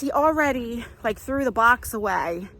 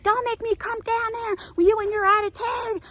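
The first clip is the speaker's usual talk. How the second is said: stern big brother; 11 semitones higher than usual. Retold or imitated. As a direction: scold the younger brother like a protective big brother. Older brother scolding, voiced.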